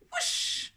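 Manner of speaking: breathy whoosh of being yanked; unvoiced whoosh effect; whoosh sound effect. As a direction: blow out a rushing whoosh.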